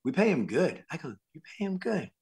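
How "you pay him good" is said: soft, high-pitched disbelieving question; incredulous echo. Retold or imitated. Waiter echoes film crew's answer, hushed high disbelief. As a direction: echo it back small and high, amazed.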